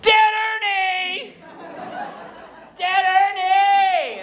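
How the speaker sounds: high, drawn-out gleeful yell; long, high-pitched mock-child shriek; child's scream. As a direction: scream it like a three-year-old. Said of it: "and I would scream".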